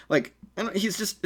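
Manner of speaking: ordinary delivery, nothing marked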